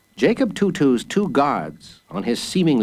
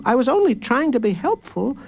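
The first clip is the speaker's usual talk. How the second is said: protesting again; 12 semitones higher than usual. A narrator's reading. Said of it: "protested Jacob Tutu".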